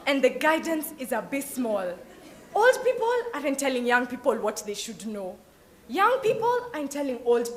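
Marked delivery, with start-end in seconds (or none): none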